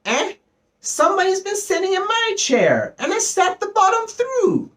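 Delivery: very high, tiny bear squeal; little wee bear voice. A narrator's reading. Little wee bear finds his porridge all gone, little wee voice. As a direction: shrink it to a sharp, shrill little voice. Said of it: "said the little wee bear in his little wee voice".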